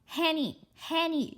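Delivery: high eager calls of her friend's name; calling out. Acted. Girl calling her friend Hanny urgently at the door. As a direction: call Hanny loudly to the door.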